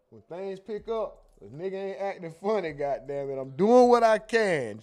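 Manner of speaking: getting fired up, promising to keep up; worked-up. Real, not acted. To his chat, promising to keep up, getting fired up. Worked-up pledge about reading chats.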